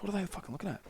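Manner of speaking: put-on confused traveller under his breath at the kids; puzzled aside. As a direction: drop it right down, baffled.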